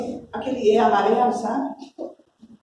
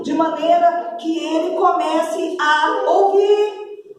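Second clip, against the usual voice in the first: fervent prayer; 7 semitones higher than usual. Retold or imitated. Preacher reenacting her prayer for the deaf boy, fervent. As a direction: pray over the deaf son with conviction.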